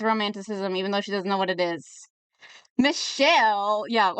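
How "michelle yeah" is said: put-on slow, drawn-out Valley Girl. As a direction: stretch the name like a Valley Girl. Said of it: "try to do Valley Girl voice".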